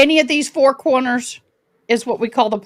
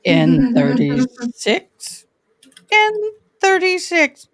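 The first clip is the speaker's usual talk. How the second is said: bingo caller; much slower than usual. Shown as a demonstration. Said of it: "I used to be".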